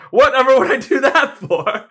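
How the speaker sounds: hammed-up disbelieving snob; mock incredulous noble. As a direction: scoff like a spoiled Roman. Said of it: "he's like".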